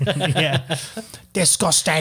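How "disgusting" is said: mock Irish disgust.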